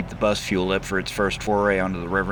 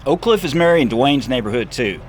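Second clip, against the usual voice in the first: louder, higher showman; Seth's voice; 8 semitones higher, 6 dB louder than usual. Acted. Seth defends Dee driving his bus, swaggering and loud. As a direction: puff it up with swagger.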